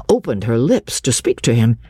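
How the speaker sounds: ordinary delivery, nothing marked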